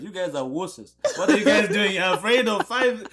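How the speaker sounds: high, loud, laughing teasing of the wusses; mock them while laughing; teasing laughter, slowing near the end; laughing mockery. Mocking people who fear snow.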